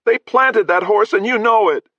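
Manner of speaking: high, loud accusing cry; angry shout. Acted. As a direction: hurl the accusation. Boy yelling accusation.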